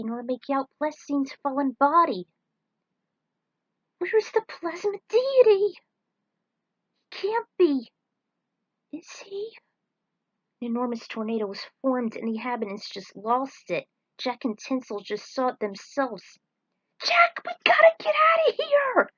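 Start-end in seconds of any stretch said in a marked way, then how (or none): 4.0-7.9 high, slow anxious searching; fearful disbelief. Acted. Tinsel searching for the fallen deity, high and fearful. 8.9-9.6 breathless whispered question; horrified whisper. Acted. Tinsel fearing her friend is dead, whispered. Dread at possible death. 17.0-19.1 very high, loud frantic cry; panicked urging. Acted. Tinsel begging Jack to escape the tornado, panicked.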